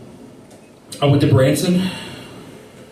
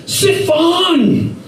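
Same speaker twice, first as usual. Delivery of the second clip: barked order; 12 semitones higher than usual. Retold or imitated. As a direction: bark it at him. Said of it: "And I said".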